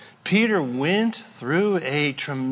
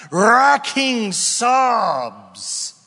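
slow; 4 semitones higher, much slower than usual. Acted. Describing Peter weeping.

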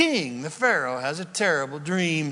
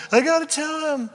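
much higher, straining to burst out; can't hold it in any longer; bursting urgency; 11 semitones higher, 4 dB louder than usual. Acted. Joseph can't hold back.

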